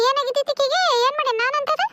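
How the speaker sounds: high-pitched, affronted questioning; indignant protest. Acted. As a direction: pitch it up as if wrongly accused. Aunt protests in family quarrel.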